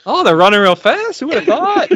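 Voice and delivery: put-on high, amazed old-time announcer; bewildered announcer marvelling at the horses; race announcer. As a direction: call the race like a baffled showman. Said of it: "announcing, like, a race".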